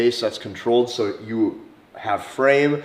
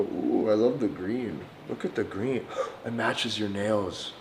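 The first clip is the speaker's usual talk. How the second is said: soft, slow, breathy-free cooing, much softer; dumbed down speech; 2 semitones lower, 8 dB quieter than usual. Shown as a demonstration. Showing men flirting over a green bottle, soft and slow. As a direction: coo about the bottle's colour. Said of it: "dumbed down speech".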